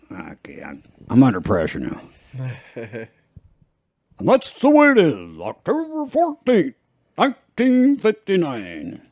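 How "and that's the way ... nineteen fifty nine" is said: Walter Cronkite impression. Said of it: "That's Walter Cronkite".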